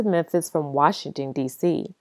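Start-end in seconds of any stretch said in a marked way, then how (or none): none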